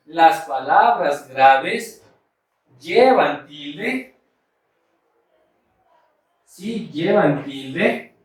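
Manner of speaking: slow, word by word, for note-taking; slow dictation. Shown as a demonstration. Teacher dictating the rule for students to copy, slow. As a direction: dictate it slowly so they can write. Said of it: "Ahora vamos a anotar la regla".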